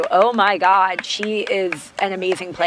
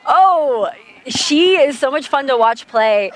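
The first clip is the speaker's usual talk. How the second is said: excited; 5 semitones higher, 3 dB louder than usual. Real, not acted. Cheering a player's run.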